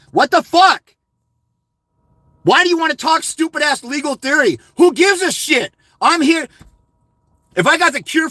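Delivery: fed-up yell at viewers asking theory; exasperated rant. Real, not acted. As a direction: blow up at the question. Ranting about legal theory.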